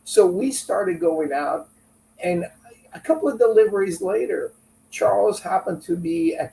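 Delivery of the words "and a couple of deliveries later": normal talking voice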